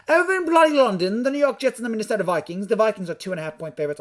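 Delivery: hammed-up British accent, starting high and loud; terrible British accent. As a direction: do a badly faked British accent, easing down from a big opening. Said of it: "that was a terrible British accent".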